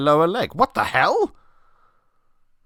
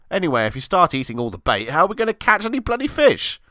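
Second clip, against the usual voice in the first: higher, faster indignant mate; 8 semitones higher, faster than usual. Acted. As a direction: argue back, rattled. Friend arguing with Chris.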